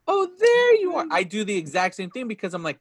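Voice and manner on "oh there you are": very high, loud cry of recognition; movie recognition quote. Retold or imitated. Retelling the film moment of recognizing Peter, high delighted cry. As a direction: imitate a child's high-pitched delighted discovery. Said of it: "when he finally recognizes Peter, he goes".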